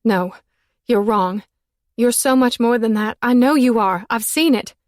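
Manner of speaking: high, loud pleading, building. Acted. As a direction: insist on his goodness, rising. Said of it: "pleading with him".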